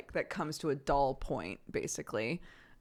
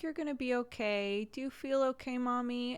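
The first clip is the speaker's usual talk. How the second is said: put-on high, soft little-kid voice; toddler comforting mom; 7 semitones higher, 3 dB quieter than usual. Mom imitating her toddler comforting her, high soft child voice. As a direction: gently imitate a small child soothing mommy.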